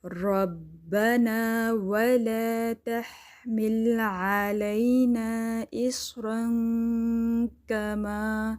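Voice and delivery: very slow melodic tajweed chant; Quran recitation. Shown as a demonstration. Teacher recites the whole verse for the class. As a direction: chant the verse, vowels held long. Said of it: "اس کو تھوڑا پڑھ لیتے ہیں".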